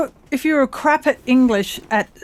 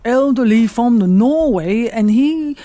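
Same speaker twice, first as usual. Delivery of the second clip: put-on elderly Norwegian's accent. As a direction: imitate a Norwegian climbing up, falling at the end. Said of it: "he talks like this".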